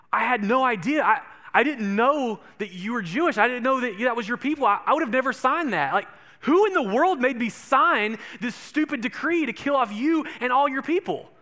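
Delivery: loud, high, indignant outburst; a king exploding at the plot against his queen; outraged king. Acted. King to Esther, loud and outraged.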